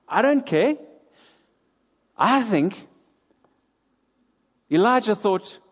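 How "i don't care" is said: high, careless brush-off; dismissive queen. Retold or imitated. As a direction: wave it away like a bored queen. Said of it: "she just sits there and she says".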